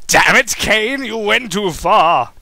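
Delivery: loud, slow furious shout; angry yell. Acted. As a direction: yell at him in anger. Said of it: "he yelled angrily".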